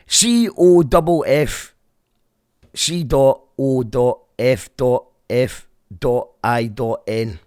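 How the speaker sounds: slow letter by letter spelling; slowly spelling a band name letter by letter; spelled out. Shown as a demonstration. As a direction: spell it out deliberately, dot by dot. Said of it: "That's what they're called".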